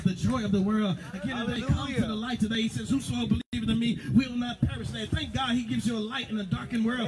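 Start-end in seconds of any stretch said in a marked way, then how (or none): 1.1-7.1 high, fervent preacher's cry; preaching. Acted. Preacher rousing the congregation, fervent preaching. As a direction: preach it out full force to the church.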